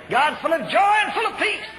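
high, loud proclamation; exultant preaching shout. Real, not acted. Preacher declaring God's joy to the congregation, exultant shout. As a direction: cry it out to the whole church.

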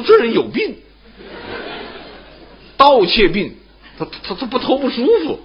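high, laughing verdict; a king scoffing that the man is sick, pitched high; amused King of Chu. Acted. King judging the thief, amused. (楚王高声笑着断定此人有病)

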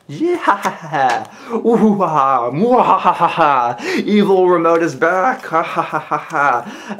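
hammed-up evil cackle; mad scientist. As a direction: cackle like a cartoon villain. Evil remote is back, cackling.

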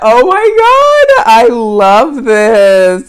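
high, loud and slow; excited gushing. Real, not acted. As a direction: draw it out in pure delight. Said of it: "getting so excited".

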